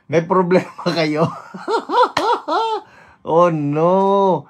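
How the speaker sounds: loud laughing disbelief. Real, not acted. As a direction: burst out laughing at the cold. Laughing at cold temperatures.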